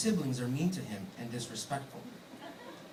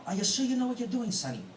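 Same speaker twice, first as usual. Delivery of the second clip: a light, high-pitched put-on Irish brogue; imitating her mother-in-law in a hammed-up Irish accent; mock Irish accent; 7 semitones higher than usual. Groom imitates the bride's mom quizzing him, in a playful mock Irish accent. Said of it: "in her leprechaun accent".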